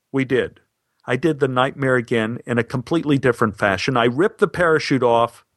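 ordinary delivery

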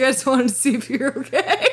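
high, whiny fake sob; mock crying. Imitating herself crying to her ex, hammed-up tearful whine. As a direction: wail it tearfully, half laughing. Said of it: "I'm literally crying".

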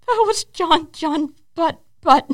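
slow, high, dazed naming of him; awestruck, stretched out; starstruck. Retold or imitated. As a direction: say his name like you can't believe it. Starstruck answer.

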